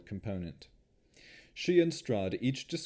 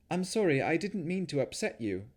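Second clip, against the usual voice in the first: apologetic; 8 semitones higher than usual. Acted. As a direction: soothe his temper. Navara apologizes, placating.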